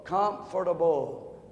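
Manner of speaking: comfortable stretched out slowly and softly; comfortable read dreamily slow; slow drawn-out word. Retold or imitated. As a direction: draw out every syllable of comfortable. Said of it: "read this word real slow".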